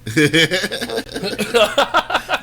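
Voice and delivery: high, loud burst of laughter; laughing. Real, not acted. Amused listener to an accent story, real high laughter.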